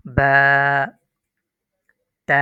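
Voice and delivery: বা and তা each held one beat; বা, তা, sung out by one measure; one-alif stretch. Shown as a demonstration. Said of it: "এক আলিফ টান হবে". (বা, তা এক আলিফ টানে)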